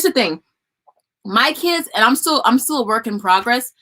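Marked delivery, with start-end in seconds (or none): none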